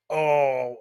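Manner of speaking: ordinary delivery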